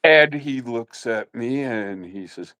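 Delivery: ordinary delivery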